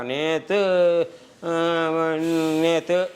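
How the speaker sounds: halting, drawn-out recall with gaps; struggling to remember. Acted. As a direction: grope for the memory, stretching the word. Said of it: "சுருங்கி விரிவு தன்மை கொஞ்சம் கம்மி". (ஞாபகம் வராம தயங்கி இழுத்து)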